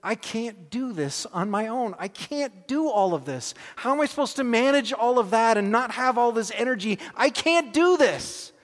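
kneeling man crying out to the Lord; desperate prayer. Retold or imitated. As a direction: break down before God, begging. Dropped to my knees and went.

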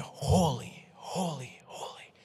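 mock booming voice fading to a whisper; distant angelic cry, dying away; thundering angels, hushed. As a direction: imitate far-off thundering voices under the breath. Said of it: "thundering voices".

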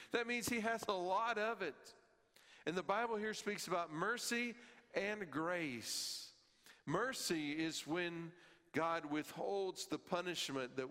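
fervent sermon delivery; preaching. Acted. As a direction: drive the point home to the congregation. Preaching at raised pitch.

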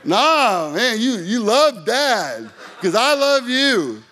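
loud, laughing "nah, man", drawn out slowly; playful pushback to son. Retold or imitated. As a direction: tease him loudly while laughing, refusing his words. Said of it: "I'll be like".

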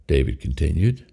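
plain reading voice

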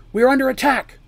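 loud, high warning shout; cried alarm. A narrator's reading. Mercy warns the keep of attack, a loud high cry. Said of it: "cried Mercy".

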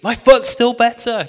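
high, loud call from a distance; shouting down the street. Retold or imitated. Woman calling back to the preacher about her foot, shouting. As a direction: yell it down the street, thrilled. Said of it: "she shouts down the street".

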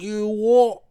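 put-on loud, high "You what?" in a rough British accent; mock British chav. Mimicking the trash of the U K in a loud rough British accent. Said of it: "the trash of the U K".

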